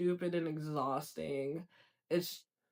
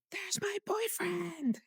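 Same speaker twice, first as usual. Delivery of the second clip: pet talk; 13 semitones higher, 7 dB quieter, slower than usual. Real, not acted. Cooing at the neighbor's cat.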